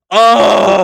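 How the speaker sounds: sudden loud high exclamation; exasperated oh. Retold or imitated. Complaining about a hundred and seventeen degrees, loud exasperated cry. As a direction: blurt it out, fed up with the heat. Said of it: "and it's like".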